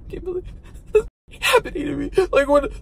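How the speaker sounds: high, breaking, tearful whine; high tearful whimper, almost sobbing; near tears. Retold or imitated. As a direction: retell it on the edge of crying, voice cracking. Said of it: "I'm like".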